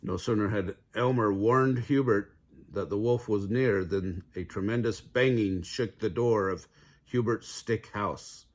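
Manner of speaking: plain reading voice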